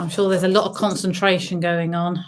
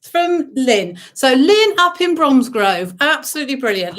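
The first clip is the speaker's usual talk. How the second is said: excited; 7 semitones higher, 3 dB louder than usual. Real, not acted. Someone shouts bingo, excited.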